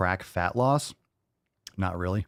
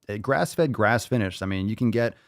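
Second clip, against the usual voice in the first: ordinary delivery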